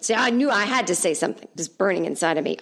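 usual speaking voice

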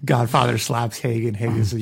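ordinary talk, nothing marked

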